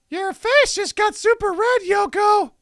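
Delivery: put-on high, loud child's voice; squeaky young boy exclaiming; kid voice. Narrator voicing a boy, high child voice.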